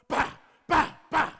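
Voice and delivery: loud, sharp "bah" bursts; imitated gunshots. Storyteller imitating sudden shots at the back door, loud imitated gunshots. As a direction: bang out mock gunfire.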